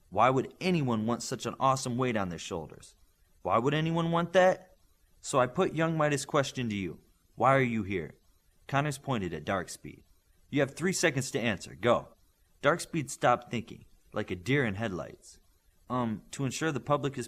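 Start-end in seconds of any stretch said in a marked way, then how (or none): none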